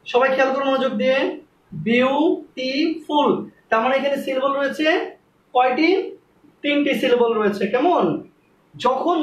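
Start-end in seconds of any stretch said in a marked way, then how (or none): 1.7-3.3 beautiful split slowly into বিউ, টি, ফুল; very slow syllable-by-syllable beautiful; broken into syllables. Shown as a demonstration. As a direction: say beautiful as three drawn-out syllables with pauses. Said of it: "উচ্চারণ করতে গেলে ভেঙে ভেঙে".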